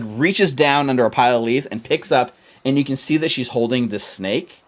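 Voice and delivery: ordinary talk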